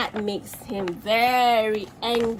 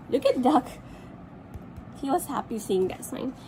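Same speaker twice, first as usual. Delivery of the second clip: ordinary delivery, nothing marked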